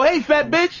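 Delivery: a lightly voiced swaggering guy; mock pickup line. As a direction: toss it out like a cocky player. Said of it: "they come up to a fat bitch and go".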